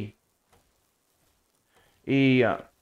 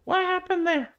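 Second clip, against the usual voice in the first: baffled; 4 dB quieter than usual. Real, not acted. Baffled by a game glitch.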